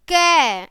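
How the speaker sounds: loud "कै" with the ए ringing out; vowel sounded out. Shown as a demonstration. Teacher proving the vowel to children, loud drawn-out "कै". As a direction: punch out "कै", holding its ए vowel. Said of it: "ए आ रहा है ना".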